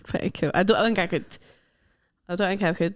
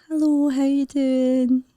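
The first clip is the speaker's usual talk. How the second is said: slow, slightly higher put-on greeting; piano teacher lady; 4 semitones higher, slower than usual. Shown as a demonstration. Teacher greeting a pupil at a lesson, piano teacher lady voice. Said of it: "your piano teacher lady character".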